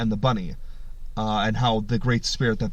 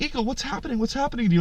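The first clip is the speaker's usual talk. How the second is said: high, softer mock-worried cry; alarmed concern; 10 semitones higher, 4 dB quieter, faster than usual. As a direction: call up anxiously to a friend in trouble. Animals alarmed by screaming eagle.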